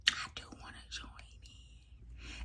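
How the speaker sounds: slow coy whisper; sly whispered quote. Retold or imitated. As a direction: breathe it like a shy confession. Quoting baby girl, whispered.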